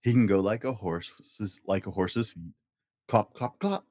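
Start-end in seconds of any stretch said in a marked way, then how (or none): none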